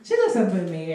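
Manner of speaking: normal talking voice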